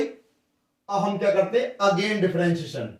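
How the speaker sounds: ordinary delivery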